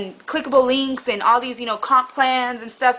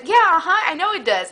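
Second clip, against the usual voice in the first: cheery; 6 semitones higher, 3 dB louder than usual. Retold or imitated. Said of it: "she was cheery like".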